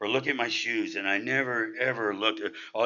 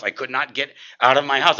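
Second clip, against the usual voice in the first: ordinary talk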